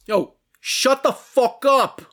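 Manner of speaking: loud, drawn-out Jersey accent. Shown as a demonstration. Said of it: "do it in your Jersey accent".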